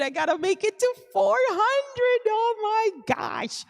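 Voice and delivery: high, stretched-out amazement; thrilled, high-pitched exclamation; excited. Real, not acted. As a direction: climb high with excitement and draw out the words. Excited about a new subscriber goal.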